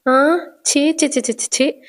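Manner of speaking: repeated little tuts of disgust, acted; mock disgust. Shruti sees her aunt seated outside the house, mock disgust. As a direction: shoo it away with a string of "chhee".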